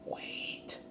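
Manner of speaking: breathy urgent whisper. A narrator's reading. Jack calling Slim back near the camp, whispered. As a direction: hiss it so the rustlers can't hear. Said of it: "whispered Jack".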